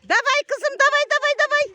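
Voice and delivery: very high, loud cheering. Real, not acted. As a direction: yell encouragement at a racer. Mother cheering daughter in ski race.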